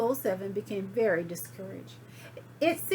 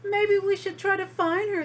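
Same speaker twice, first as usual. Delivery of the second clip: put-on high, loud children's voice; eager kids; 11 semitones higher, 6 dB louder than usual. Children wanting to find their teacher, high. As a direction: pipe up like hopeful kids. Worried kids plan search.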